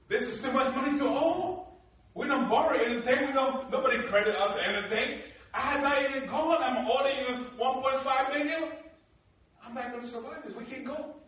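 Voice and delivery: high, loud, rushed protest over the debt; panicked protest. Retold or imitated. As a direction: plead that it's a trap, voice up high. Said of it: "I'm like".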